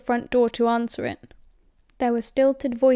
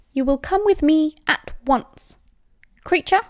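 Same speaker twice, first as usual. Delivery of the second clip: loud, higher imperious order; haughty raised-voice demand; cold command; 5 semitones higher, 5 dB louder than usual. A narrator's reading. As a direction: order him sharply, expecting obedience. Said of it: "she said coldly".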